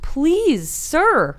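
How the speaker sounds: high, pleading little voice; mock pleading. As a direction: beg him, hammed up. Mock plea to the attacker.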